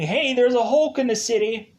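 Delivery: high, urgent heads-up; mock alarmed report. Military reporting a Hulk to Ross, high mock alarm. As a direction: raise the alarm, lightly acted.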